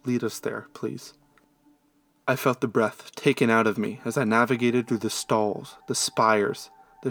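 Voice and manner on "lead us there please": soft, reluctant-sounding mumble; mumbled. A narrator's reading. Girl agreeing to be led to the inn, mumbling softly. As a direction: mumble it well under your usual volume. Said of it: "the girl came to me and mumbled".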